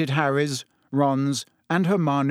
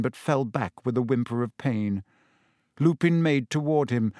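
usual speaking voice

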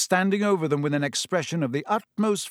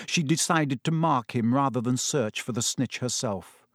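plain reading voice

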